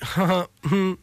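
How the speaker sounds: mock-cutesy little hum. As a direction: imitate him being cutesy. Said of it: "cutesy little, like".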